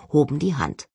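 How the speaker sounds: usual speaking voice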